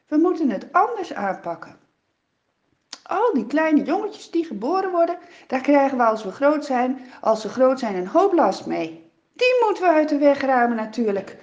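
a wicked king laying down orders, loud; scheming pharaoh. Acted. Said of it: "Hij zegt van".

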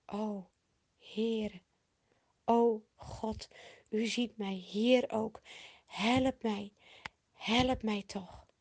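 hushed, halting plea with pauses; pleading prayer. A narrator's reading. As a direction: implore gently, phrase by phrase. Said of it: "En weer bidt hij".

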